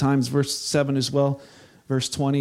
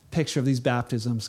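ordinary talk

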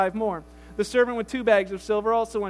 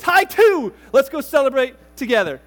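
excited cheer; 2 semitones higher, 8 dB louder than usual. Acted. Master celebrating servant.

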